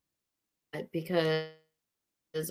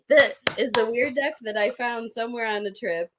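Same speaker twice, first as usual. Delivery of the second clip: ordinary talk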